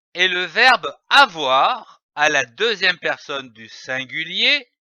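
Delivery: usual speaking voice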